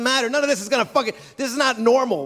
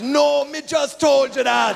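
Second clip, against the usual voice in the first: put-on much higher, slow Jamaican accent, exasperated; Jamaican guide, annoyed; 8 semitones higher, much slower than usual. Guide answering the tourist in a slow mock Jamaican accent. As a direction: drag it out in Jamaican, fed up. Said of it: "And he goes".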